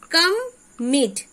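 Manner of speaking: "come, meet" as two separate beats with a pause between; split into syllables. Shown as a demonstration. Teacher showing children the two syllables, "come, meet" split by a pause. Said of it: "Two syllable are there".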